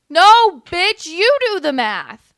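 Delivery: loud, slow, furious retort; angry outburst. Real, not acted. Streamer yelling back at the candidate on screen, furious. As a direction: snap it back at her, furious. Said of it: "I'm getting angry at her".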